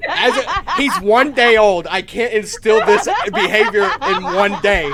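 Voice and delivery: loud, high laughing protest. Real, not acted. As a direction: plead through laughter. Laughing defense to party.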